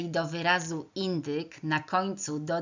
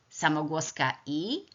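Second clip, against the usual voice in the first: ordinary talk, nothing marked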